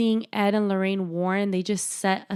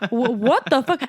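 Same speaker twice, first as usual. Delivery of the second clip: loud, higher burst of disbelief; shocked disbelief; 4 semitones higher, 7 dB louder than usual. Retold or imitated. Viewer reacts to the eerie whispering, shocked disbelief. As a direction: blurt it out, thrown by what you saw.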